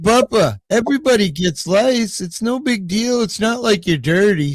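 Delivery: high-pitched little granddaughter voice; imitated granddaughter. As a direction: imitate a child reassuring Grandpa, pitched well up. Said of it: "my granddaughter's like".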